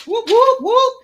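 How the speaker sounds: very high, short whoops; three quick hooting cheers; celebratory whoops. Real, not acted. Cheering during the roll call.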